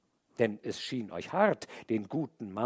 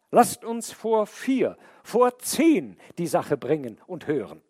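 louder, higher pleading protest; indignant man; 9 semitones higher, 8 dB louder than usual. A narrator's reading. The man protests to the snake and judges, loud and raised. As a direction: demand a fair hearing. The man argues his case.

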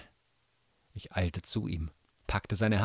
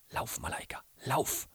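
very soft, hushed command; urgent whisper; 4 semitones higher, 6 dB quieter than usual. A narrator's reading. Brother sending his sister away from wolves, whispering. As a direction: whisper it urgently to a loved one. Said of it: "wisperte mein Bruder".

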